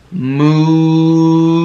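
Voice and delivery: long held Moo; playful moo for children; cow moo. Shown as a demonstration. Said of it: "That's the sound that cows make".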